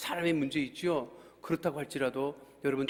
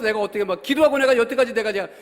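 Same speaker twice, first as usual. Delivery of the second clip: ordinary talk, nothing marked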